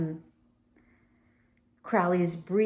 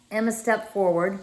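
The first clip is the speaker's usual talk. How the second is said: usual speaking voice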